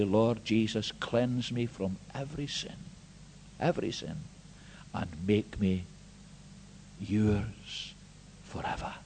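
hushed, tender plea to the Lord; soft prayer. Acted. Preacher voicing the sinner's prayer, soft and tender. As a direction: pray it quietly, almost fading out.

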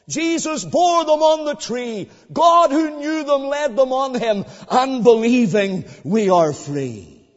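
loud, slow proclamation; preacher declaring all my sins were laid upon him; impassioned preaching. Acted. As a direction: build to a ringing climax. Preaching the atonement.

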